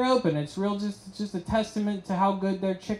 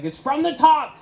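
mock scream, higher and louder; higher, louder mock nightmare yell; waking scream; 9 semitones higher, 5 dB louder than usual. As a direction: jolt awake yelling. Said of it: "I woke up screaming".

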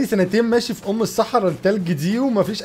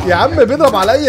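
normal talking voice

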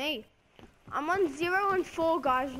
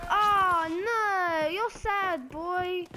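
higher, louder jokey taunt; mock taunt; 5 semitones higher, 5 dB louder, much slower than usual. Gamer taunts the player who killed him, mock trash talk. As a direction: razz the other player, half-joking. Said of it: "I'm not gonna talk like that anymore".

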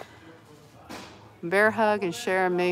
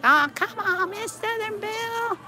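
squeaky toy voice; 14 semitones higher than usual. Pretend. Voicing toy answering back.

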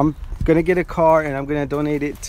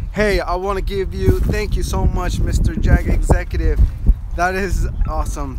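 ordinary delivery

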